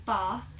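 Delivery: "bath" with a long back "ah"; British "bath" with the vowel of "car". Shown as a demonstration. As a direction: say "bath" as southern English "bahth". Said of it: "using the R vowel".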